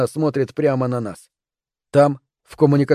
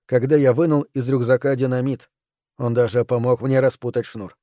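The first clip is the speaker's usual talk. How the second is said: ordinary delivery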